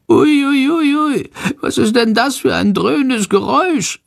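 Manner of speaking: high, frightened little squeal; anxious. A narrator's reading. Bat hears the roaring turbines, asks anxiously in a high voice. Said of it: "Fragt er ängstlich".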